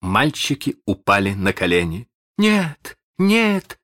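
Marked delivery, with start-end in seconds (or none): 2.4-3.8 put-on high, pleading cry of scared boys; pitiful cry. Captured boys beg the pirates, high and pitiful. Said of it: "закричали они так жалобно".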